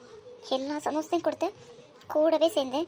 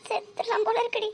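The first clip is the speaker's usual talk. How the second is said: high, quiet crying voice; high-pitched, tearful lament; tearful despair; 8 semitones higher, 6 dB quieter than usual. Acted. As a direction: sob through the words in despair. Tearful despair over the engagement.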